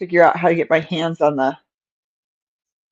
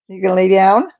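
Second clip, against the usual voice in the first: ordinary talk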